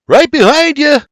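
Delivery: high, excited holler. A narrator's reading. George hollering as he chases his father into the water. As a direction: shout as you leap up to follow.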